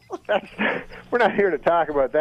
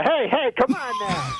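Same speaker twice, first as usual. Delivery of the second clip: shout; 12 semitones higher than usual. Pretend. Norm scolding puppet, pitched up.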